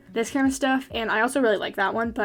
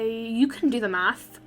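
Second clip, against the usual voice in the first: ordinary talk, nothing marked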